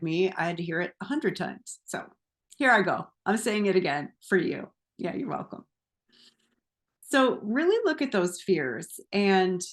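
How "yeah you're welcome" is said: breathy whispered yeah, you're welcome, much softer; playful whisper under the breath; whispered aside. Acted. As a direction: toss off a teasing whisper to the viewer. Cheeky aside after repeating advice.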